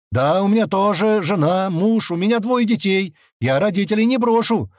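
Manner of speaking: high-pitched, agitated protest; indignant crowd. A narrator's reading. Parents in the crowd protesting about their children, indignant. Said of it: "снова начали возмущаться люди".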